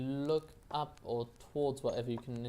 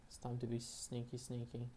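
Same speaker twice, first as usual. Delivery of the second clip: much softer, hushed sneaky voice; 6 dB quieter than usual. Pretend. Player creeping past the guard, hushed. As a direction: drop right down to sneak. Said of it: "announcing sneaking".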